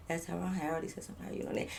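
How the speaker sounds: fast hushed follow-up; back to quiet. Retold or imitated. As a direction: drop to a quick hush. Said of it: "but you get back quiet".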